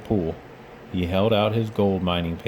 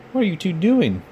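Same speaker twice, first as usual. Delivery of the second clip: surprised; 10 semitones higher, 4 dB louder than usual. A narrator's reading. Jessie surprised.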